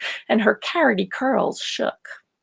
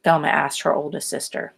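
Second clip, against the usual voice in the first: plain reading voice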